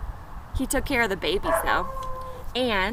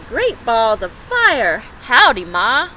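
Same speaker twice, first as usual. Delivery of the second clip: cowboy gosling; 7 dB louder, slower than usual. Acted. Newborn goose greets Jack.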